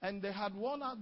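usual speaking voice

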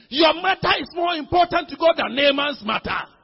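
loud, high preacher's declaration; forceful preaching. Acted. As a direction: proclaim it to the whole congregation. Preacher drives point home to congregation.

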